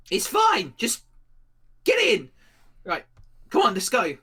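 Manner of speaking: loud, high, hurrying orders; urgent shouting. Acted. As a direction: shout to rush them inside. Acted character urgently hurrying people inside.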